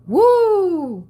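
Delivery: a high, loud whoop of delight; excited whoop. Real, not acted. Tarot reader to viewers, overwhelmed by the message: high loud whoop. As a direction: let out a real burst of joy.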